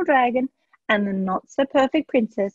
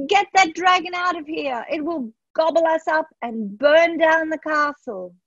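higher, frantic order to remove the dragon; panicked king; 7 semitones higher than usual. A narrator's reading. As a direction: shout in alarm from the bushes.

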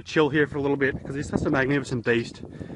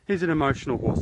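ordinary talk

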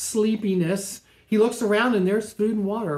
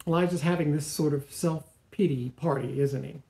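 usual speaking voice

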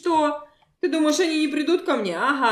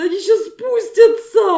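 louder, slower panicked warning; alarmed cry; 8 semitones higher, 4 dB louder than usual. Pretend. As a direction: call out the danger in alarm.

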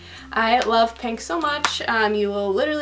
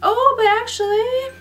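surprised; 13 semitones higher, 4 dB louder than usual. Real, not acted. Sudden change of plan.

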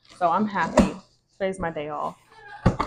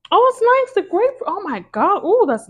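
high, loud delighted squeal; thrilled by the first sniff; excited; 14 semitones higher, 11 dB louder than usual. Real, not acted. First sniff of grapefruit candle.

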